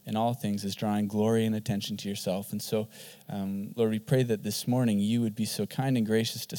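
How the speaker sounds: soft, low prayer voice. Acted. Pastor praying before his sermon, soft and low. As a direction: pray quietly over the congregation.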